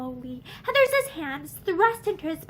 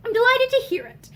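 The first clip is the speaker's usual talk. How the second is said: bitter sarcasm; 8 semitones higher, 6 dB louder than usual. A narrator's reading. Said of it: "he told her with bitter sarcasm".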